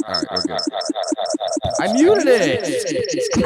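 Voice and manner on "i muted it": very high, drawn-out loud yell; frantic shout. Real, not acted. Host realising the mute mishap, frantic shout. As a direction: yell it long over the racket. Shouts over alarm noise.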